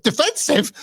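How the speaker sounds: high, scoffing echo of the word; imitating Shawn, outraged; mock incredulous. Retold Shawn scoffing at Vince.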